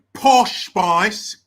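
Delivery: loud, put-on British pronunciation; mock British accent. Shown as a demonstration. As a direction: punch out the name in British. Name repeated in British accent.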